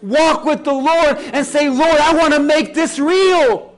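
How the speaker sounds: loud, high, driving sermon delivery; full-force preaching. Real, not acted. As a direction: preach it at the top of your voice, leaning on key words.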